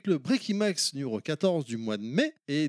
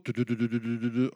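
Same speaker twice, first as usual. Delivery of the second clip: vocal drumroll, tu tu tu; 4 semitones lower, faster than usual. Shown as a demonstration. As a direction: imitate a drumroll. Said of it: "roulement de tambour".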